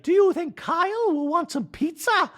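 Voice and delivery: high, bright-pitched doting voice; mock hostess, pitched far up; Dorit impression. Wife asking husband about guest, high mock voice. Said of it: "Dorit's like".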